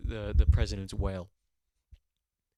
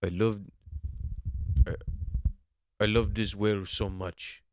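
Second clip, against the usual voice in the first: halting, badly faked Russian accent; Vladimir Putin's Russian accent; 2 semitones lower than usual. Leader gushing over his pet whale, badly faked Russian accent. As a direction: feel your way into a clumsy Russian accent. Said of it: "I can't do a Russian accent, can I?".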